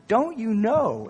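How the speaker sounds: high, slow reproach; rebuking question. Retold or imitated. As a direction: stretch it into a pointed rebuke. Voices Paul rebuking the church.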